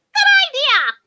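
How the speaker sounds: very high, loud fairy cry; delighted exclamation. A narrator's reading. Half-deaf fairy taking up the suggestion, delighted.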